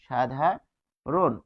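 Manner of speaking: "সাধারণ" very slow, split as shadha, ron; demonstrated pronunciation. Shown as a demonstration. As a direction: draw out "সাধারণ" with a pause before ron. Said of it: "এখানে তো বাদ দিলাম". ("সাধারণ" ধীরে, শাধা-রোন)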